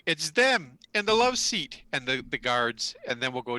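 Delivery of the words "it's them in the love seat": loud, high accusing shout; alarmed call. Acted. Game master voicing someone pointing out intruders to guards, alarmed shout. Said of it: "you hear a voice going".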